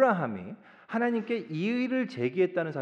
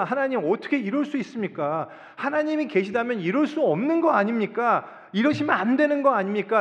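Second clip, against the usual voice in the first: raised, pleading challenge to God; protesting question; 6 semitones higher, 4 dB louder than usual. Acted. Believers questioning God over the suffering of innocents, protesting. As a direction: question God insistently, voice pitched up. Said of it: "라고 이렇게 질문하잖아요".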